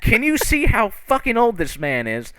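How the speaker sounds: high-pitched outraged telling-off; acted righteous indignation; indignant scolding. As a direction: berate him on someone else's behalf, voice climbing. Character scolds on old man's behalf.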